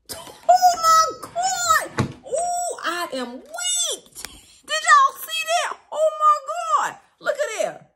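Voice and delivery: very high, loud, drawn-out shriek; high squealing disbelief that spills into laughter; shocked shriek. Real, not acted. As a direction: scream it in stunned delight. Reader shocked by the cards.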